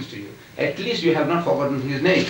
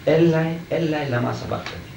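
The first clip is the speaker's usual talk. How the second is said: ordinary talk, nothing marked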